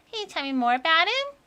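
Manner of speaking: put-on small child asking, lighter voice; little kid voice. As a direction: play a curious little kid wide-eyed. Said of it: "the little kid voice that I'm doing".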